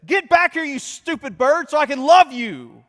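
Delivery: yelled high and loud out the window at the bird; angry yell. Retold or imitated. Sheldon yells out the window at the escaped bird, furious yell. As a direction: bellow it, furious but comic. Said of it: "changes from sadness to anger".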